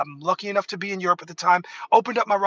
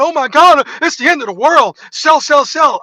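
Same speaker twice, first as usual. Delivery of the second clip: high, loud mock panic; 7 semitones higher, 10 dB louder than usual. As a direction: wail it like the world is ending. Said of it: "everyone was freaking out".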